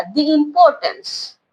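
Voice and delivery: "दी" with long ee before importance; dee, running into importance. Shown as a demonstration. Teacher confirms the answer, "दी" as dee. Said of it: "दी आएगा".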